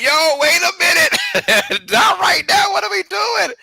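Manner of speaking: high, half-laughing reenacted yelp; retold panic. Fan reliving the stream cutting out, high mock panic. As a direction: replay the panic at the frozen screen. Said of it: "I was like".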